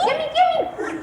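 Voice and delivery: high, squealing gimme; snatch at it greedily; excited grabbing. Acted. Sisters grab the invitations.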